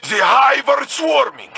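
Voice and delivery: high, loud warning; alarmed battle cry; urgent shout. Acted. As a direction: call it out over the swarm.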